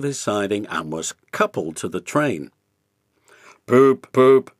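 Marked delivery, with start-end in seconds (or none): 3.7-4.5 big engine whistle. A narrator's reading. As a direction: toot like a big express. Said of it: "he whistled".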